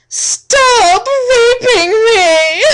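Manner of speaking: slow, loud, high pleading cry; anguished scream. Acted. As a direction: wail it out in desperate pain. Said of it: "I cried".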